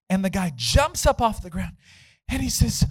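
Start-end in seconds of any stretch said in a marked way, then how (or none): none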